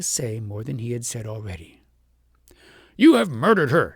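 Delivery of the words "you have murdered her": louder, furious; accusing shout. A narrator's reading. King shouts his accusation at the shepherd, loud. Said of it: "shouted the king".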